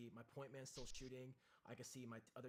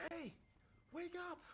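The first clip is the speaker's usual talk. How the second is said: calling to wake. Retold or imitated. Friend kicking him awake.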